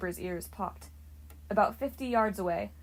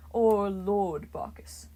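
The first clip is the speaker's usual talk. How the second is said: slow, lordly Lord Bacchus; a wine god savoring his own title; grand title, said slowly; 3 dB louder, much slower than usual. A narrator's reading.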